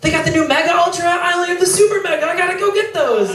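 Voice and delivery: put-on high, fast gushing girl voice; excited girlfriend. Imitating a girl friend gushing about new products, fast and high. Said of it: "a friend that's a girl that's like".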